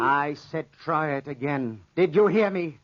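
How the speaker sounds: bully her into it; slow threat rising to a bark; menacing command. Acted. Insisting she pet the panther.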